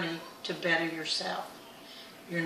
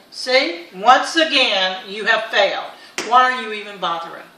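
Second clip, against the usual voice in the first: loud, emphatic mock scolding; scolding inner voice; 11 dB louder than usual. Inner critic berating her after a diet slip, scolding. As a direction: hammer out the accusation, much louder. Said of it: "that little voice starts saying".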